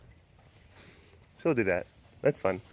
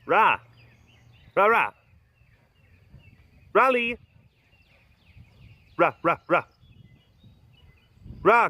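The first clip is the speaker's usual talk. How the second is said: loud, higher-pitched calls; calling a pet; 6 semitones higher, 6 dB louder than usual. Real, not acted. Calling Ra to get the ball, loud urging. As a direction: urge the animal on, loud and punchy.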